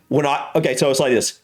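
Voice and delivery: ordinary talk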